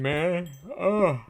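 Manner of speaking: yawning through the words. Real, not acted. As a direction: yawn while talking. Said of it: "oh, I'm gonna yawn".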